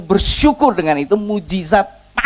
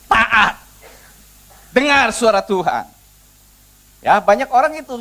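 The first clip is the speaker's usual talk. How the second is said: forceful preaching; 6 semitones higher, 6 dB louder than usual. Real, not acted. Preacher teaching the congregation to obey, forceful and emphatic. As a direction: drive the lesson home to the congregation.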